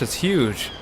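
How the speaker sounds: normal talking voice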